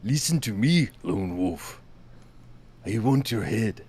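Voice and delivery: badly done Japanese accent on request. Shown as a demonstration. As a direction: growl it like a vengeful samurai. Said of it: "Tell me you're getting revenge".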